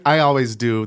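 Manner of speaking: ordinary delivery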